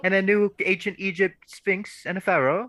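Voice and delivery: high, British gift-shop clerk voice; [name]. Acted. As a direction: offer it in a put-on British accent. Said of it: "speaking in a British accent".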